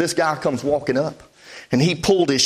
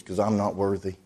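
low, hushed retelling of an unworthy reply; subdued, quiet confession; 10 semitones lower, 8 dB quieter than usual. Retold or imitated. Nick's reply to the preacher's "Why not?", quietly subdued. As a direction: drop low and soft, as if humbled. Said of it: "Nick just got real quiet".

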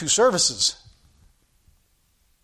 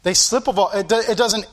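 ordinary talk

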